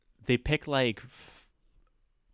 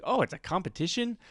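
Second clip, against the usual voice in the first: higher-pitched, rising retold disbelief; surprised question; 7 semitones higher, 3 dB quieter than usual. Comic retelling her reaction to the competition news, mildly surprised question. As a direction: echo it back as a surprised question.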